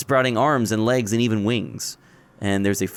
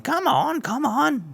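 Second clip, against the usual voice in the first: high-pitched hurrying lady; high odd woman voice; slower than usual. Acted. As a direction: urge them on, high.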